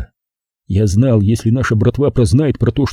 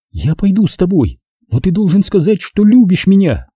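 put-on higher, girlish voice, insisting; young woman's voice; 8 semitones higher than usual. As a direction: voice Zaza high and pleading. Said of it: "Едва слышно проговорила она".

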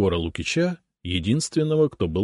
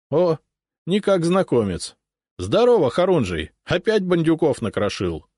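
higher, louder jovial officer voice; hearty sotnik; 10 semitones higher, 4 dB louder than usual. A narrator's reading. Sotnik jumping off his horse, hearty greeting. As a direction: greet an old friend heartily.

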